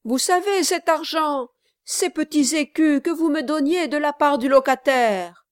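high, loud, insistent reminder; urgent appeal. A narrator's reading. Kit reminds Brass of the lodger's money, urgent appeal.

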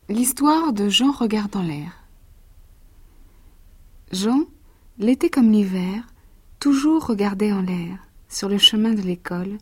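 put-on lively verse read to a child; lecture de conte. A narrator's reading. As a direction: read it like a bedtime picture book. Reads the verse tale. (lecture animée de conte pour enfants)

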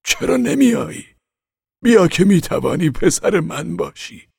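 high, slow, pleading prince's voice; coaxing prince. Acted. Prince urges the boy to come down, high slow coaxing. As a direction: coax him down like a would-be father.

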